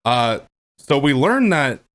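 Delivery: ordinary delivery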